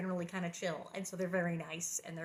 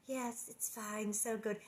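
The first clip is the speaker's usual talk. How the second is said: fake sweetness; 6 dB quieter, slower than usual. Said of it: "And they're trying to be".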